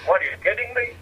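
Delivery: amused incredulity at the rabbi's swearing; incredulous outburst. Real, not acted. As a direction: throw it back at him in disbelief. Mock outrage at the rabbi.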